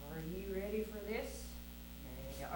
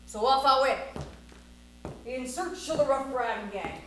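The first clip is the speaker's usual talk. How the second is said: loud, punchy cowboy-tale narration; story narration; 4 semitones higher, 11 dB louder, slower than usual. A narrator's reading. Storyteller recounts setting off to find the gang, theatrical narration. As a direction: tell the tall tale to a crowd of children.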